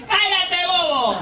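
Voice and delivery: high-pitched playful shout; playful mock scolding; mock yell. Real, not acted. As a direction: yell it half laughing. Comic yells at laughing partner.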